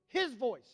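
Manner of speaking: His voice, with His punched; stress on His. Real, not acted. Preacher echoing to the church, stress on His. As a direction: lean hard on His in His voice.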